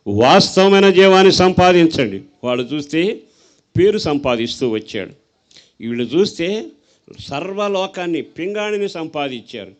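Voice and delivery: loud, fervent sermon exhortation; preacher urging the church, loud and fervent; preaching at full force. Acted. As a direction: thunder it to the congregation.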